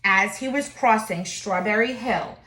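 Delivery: ordinary delivery, nothing marked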